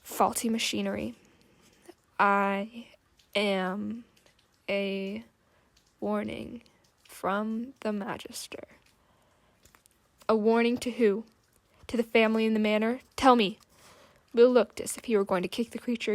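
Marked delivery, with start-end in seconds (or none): 2.2-8.6 halting, grinding mechanical voice; machine voice. Acted. Automaton delivers its master's warning, grinding and halting. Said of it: "sounded like a buzzing and grinding of faulty machinery". 10.3-13.6 louder, fast, pressing hard; angry demand. Acted. Will demands who the warning is for, loud and angry.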